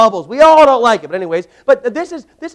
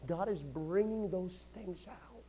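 whisper; 20 dB quieter, slower than usual. Real, not acted.

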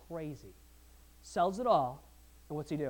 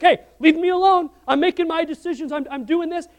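a much louder and higher Hey, leave me alone; defiant shout; 12 semitones higher, 18 dB louder than usual. Retold or imitated. Man selling everything shouts down doubting friends. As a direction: shout back and refuse to budge. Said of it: "he's like".

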